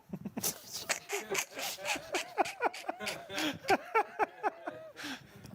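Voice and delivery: long high-pitched laugh; high burst of laughter. Real, not acted. Laughing at his own accent attempt, high laughter.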